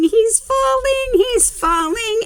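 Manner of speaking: excited alarm. Real, not acted. Storyteller to the kids as the boy tumbles, high excited alarm. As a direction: cry it out as he goes over. Building suspense over a fall.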